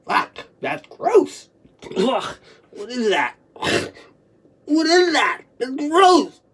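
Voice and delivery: high, loud cry of disgust; real horrified, tearful disgust; revolted distress. Real, not acted. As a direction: recoil with the voice leaping up. Revolted by a resurfacing memory.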